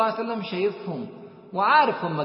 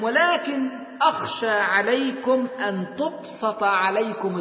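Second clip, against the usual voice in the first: higher and louder, slowing on the hadith; preaching at full force; 6 semitones higher, 5 dB louder, slower than usual. Acted. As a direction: cry the warning out to the congregation.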